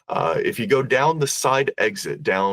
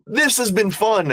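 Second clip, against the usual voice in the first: high, loud, forced cheer covering fright; panicked exit; 13 semitones higher, 5 dB louder than usual. Retold or imitated. Frightened guest fleeing the ghostly tour guide, panicked. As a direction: blurt it out while already bolting.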